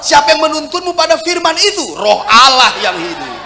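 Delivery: shouted high preaching, each line hammered; preaching at full force. Acted. Pastor preaching to the church, shouting high. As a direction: preach it out loud and high to the congregation.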